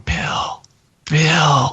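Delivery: first Bill whispered, second one voiced; eerie ghostly voice. Host mimics the recorded voice calling out Bill, eerie whisper. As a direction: imitate a faint ghost voice on a recording. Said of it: "the young woman voice saying".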